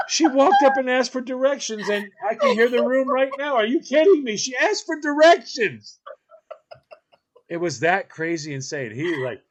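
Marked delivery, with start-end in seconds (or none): 0.1-6.0 disbelief. Real, not acted. Incredulous retelling, laughing.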